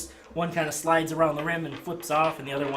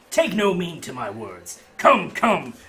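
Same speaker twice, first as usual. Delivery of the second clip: slow, loud sales pitch; carnival barker; 6 dB louder than usual. Acted. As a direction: coax the customer over.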